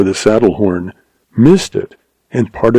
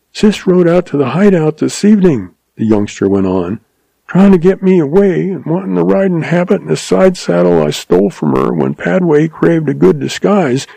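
higher young man, lightly voiced; weak wounded boy explaining; a hint of a feverish kid; feverish youngster; 7 semitones higher than usual.

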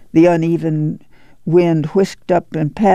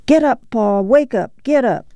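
higher-pitched call to rouse a sleeper; urgent wake-up call; 7 semitones higher than usual. A narrator's reading. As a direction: rouse him with short, raised commands. Mother waking sleeping father.

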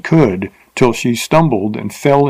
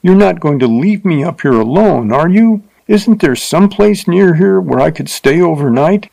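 put-on higher, pleading young woman, mildly frightened; 6 semitones higher than usual. A narrator's reading. Teacher begs the rider not to leave her, mild fear. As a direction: beg him not to leave her alone. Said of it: "forgetting her insulted dignity".

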